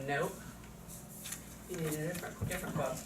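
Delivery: soft, low-key correction; calm refusal. Shown as a demonstration. Teacher rejecting a student's repeated quote, quiet matter-of-fact refusal. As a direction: turn it down quietly and without fuss.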